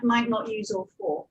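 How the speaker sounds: ordinary talk, nothing marked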